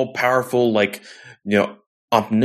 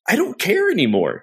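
fed up; 9 semitones higher, faster than usual. Retold or imitated. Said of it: "And I'm like".

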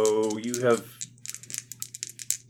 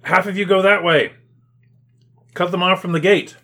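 loud, raised-pitch officer's orders; authoritative command; 9 semitones higher, 8 dB louder than usual. Acted. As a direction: shout orders to the troops. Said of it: "you hear an authoritative voice saying".

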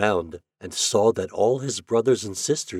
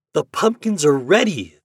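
put-on higher, louder children's announcement; excited cry; 7 semitones higher, 6 dB louder than usual. A narrator's reading. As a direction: call out the news like an eager child. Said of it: "they cried".

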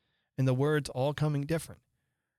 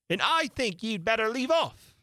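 much higher, brisk beast voice; griffin voice; 9 semitones higher than usual. Acted. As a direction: cut in like an impatient beast.

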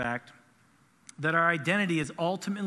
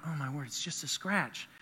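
deflated; 2 semitones lower, 6 dB quieter than usual. Retold or imitated. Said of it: "and I'm like".

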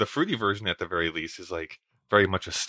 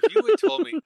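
incredulous; 6 dB louder than usual. Real, not acted. Disbelief at the joke-looking cereal box.

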